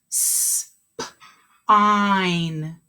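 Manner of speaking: "S, p, ein" as separate sounds, the S whispered; sounded out. Shown as a demonstration. Teacher models segmenting a word for the class, sound by sound. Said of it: "Make sure you're saying them".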